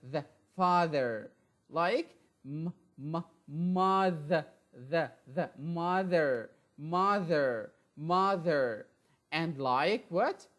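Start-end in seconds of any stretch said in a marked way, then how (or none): none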